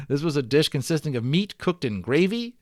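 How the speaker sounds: lively read-aloud storyteller; story narration. Acted. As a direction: tell the adventure with growing drama. Reads the adventure story aloud.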